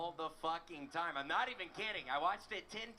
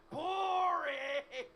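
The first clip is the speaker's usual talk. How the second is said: high, loud drawn-out boring; heckling shout; 11 semitones higher, 6 dB louder than usual. Retold or imitated. Comedian heckles an old opener, loud long boring. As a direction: bellow boring at the old man. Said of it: "and I said".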